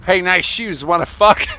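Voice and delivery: imitating a brash pickup line, high and loud; Adam. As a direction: blurt it like a joking friend's crude come-on. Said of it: "my friend Adam that I had".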